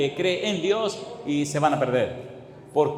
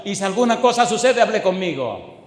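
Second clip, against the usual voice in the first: raised, high-pitched authority; emphatic boss's order; 4 semitones higher, 4 dB louder than usual. Retold or imitated. Owner tells the woman to come to him, loud and emphatic. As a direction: declare it loudly as the protective boss. Boss firmly backs worker in story.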